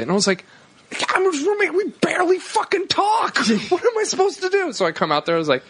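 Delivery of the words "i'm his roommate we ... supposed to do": very high-pitched comic protest; hammed-up, strained cry of exasperation; exasperated outburst. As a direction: squeal it in mock panic. Retold panicked protest to friends.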